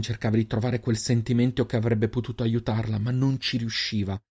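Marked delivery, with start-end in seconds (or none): none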